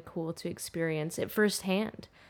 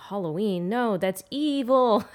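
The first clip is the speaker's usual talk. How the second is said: imitating a disapproving cousin; 4 semitones higher, 7 dB louder than usual. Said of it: "my cousins were like".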